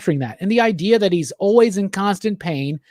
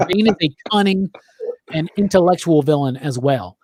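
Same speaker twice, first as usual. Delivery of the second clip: ordinary talk, nothing marked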